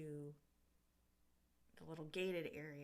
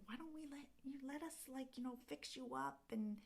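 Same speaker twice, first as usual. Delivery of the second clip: fairy voice; 8 semitones higher than usual. Retold or imitated. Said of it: "they're like".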